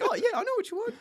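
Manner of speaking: high, cheery imitation of an American cashier; server voice. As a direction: play the cashier who suddenly understands. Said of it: "And they're like".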